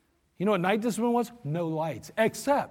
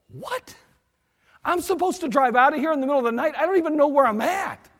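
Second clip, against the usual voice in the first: very high startled squeak, then raised disbelief; incredulous what; 6 semitones higher, 3 dB louder than usual. Retold or imitated. Driver told to drive with no lights, shocked and raised. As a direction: act stunned and indignant.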